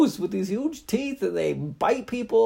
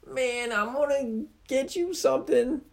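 very high, loud laughing taunt; laughing squeaky mockery; 13 dB louder than usual. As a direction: tease him through giggles. Stoogie mocking, laughing.

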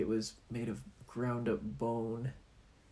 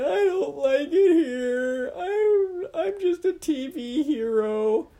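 whimpering hero; 8 dB louder, slower than usual. Acted. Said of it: "started to cry".

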